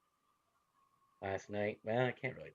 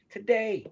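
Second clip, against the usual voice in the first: TODAY said high and loud, falling; incredulous emphasis on Today; emphatic today; 11 semitones higher, 8 dB louder than usual. Real, not acted. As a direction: hit Today hard and high, let it fall.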